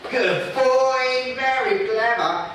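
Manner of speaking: high, slow, sing-song praise. Shown as a demonstration. Trainer praising Cody in a high, slow sing-song. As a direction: coo it up high to the dog. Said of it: "So Cody here".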